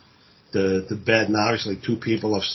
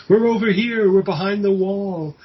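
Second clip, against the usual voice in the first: much higher, louder, eerie calling; ghostly call from the wall; 10 semitones higher, 4 dB louder than usual. Retold or imitated. Walled-up lovers haunting the killer, eerie high call.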